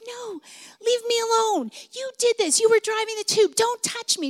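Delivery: high, loud furious refusal; angry refusing daughter. Retold or imitated. Injured daughter yelling at dad to leave her alone, high and angry. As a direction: shriek at him to stay away.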